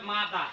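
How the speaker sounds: "মাদা" with the final r dropped; British pronunciation. Shown as a demonstration. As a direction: end "মাদা" on an open vowel, no r. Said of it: "এটাকে বিটিটা বলে মাদা".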